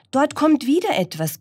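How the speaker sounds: high, excited pig character; grunting pig. Acted. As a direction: announce a sighting as the pig. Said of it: "grunzte das Schwein".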